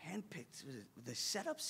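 very quiet, low, dawning question; stunned realization. Retold or imitated. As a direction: ask it hushed, as the truth sinks in. And then he realized.

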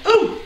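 short, high-pitched "ooh"; real little high yelp. Real, not acted. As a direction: let out a quick high ooh at the sting. Reacting to stinging onion eyes.

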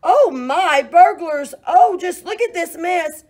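very loud, high shriek; screaming alarm. Acted. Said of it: "she let out a scream".

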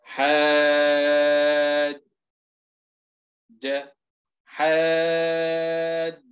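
راد chanted very slowly and loudly with the alif held long; slow loud drill. Shown as a demonstration. Teacher drilling trainees, loud drawn-out chant. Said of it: "نتهجى مثل هذه الكلمة هكذا".

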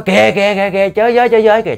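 high, insistent cries; urgent pointing out. Retold or imitated. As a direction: call out to make them look right now. Man urgently points out drowning victim. (kêu chỉ dồn dập, giọng cao)

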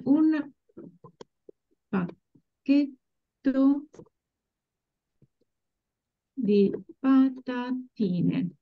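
"un pacchetto di patatine" drawn out slowly, broken into syllables; slow syllable-by-syllable model. Shown as a demonstration. Teacher modelling for students to repeat, slow syllable-by-syllable "un pacchetto di patatine". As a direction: spell out each syllable very slowly, with pauses. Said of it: "Would you like to repeat after me?".

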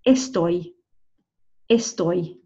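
"Estoy" as "es-TOY"; model pronunciation. Shown as a demonstration. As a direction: say "Estoy" carefully, ending like English "toy". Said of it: "the way we're gonna say that".

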